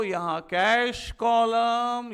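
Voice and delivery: slow dictation. Shown as a demonstration. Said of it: "slow board dictation".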